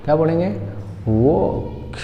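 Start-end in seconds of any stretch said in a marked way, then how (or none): 1.1-2.0 वॉक with a long rounded 'aw'; correct 'wawk'. Shown as a demonstration. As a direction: model वॉक, stretching the 'aw'. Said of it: "इसका सही उच्चारण जो है". (वॉक में लंबा ऑ बोलें)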